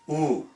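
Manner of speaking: OOH held with lips pushed forward; pouted vowel. Shown as a demonstration. Teacher showing correct lip shape, pouted OOH. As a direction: pout the lips for a long "ooh". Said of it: "So we're saying".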